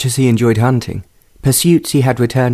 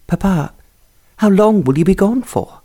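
higher, lightly voiced young lady; young daughter voice; 8 semitones higher than usual. A narrator's reading. Daughter asks her departing papa, higher and pleading. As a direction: pitch it up as the eager daughter.

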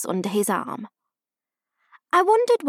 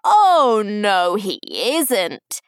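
pantomime retort; 6 dB louder, much slower than usual. A narrator's reading. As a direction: sing out a teasing panto denial.

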